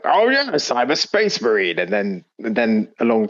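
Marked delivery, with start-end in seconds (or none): 0.0-1.8 mock Space Marine. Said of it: "The Space Marines are just like".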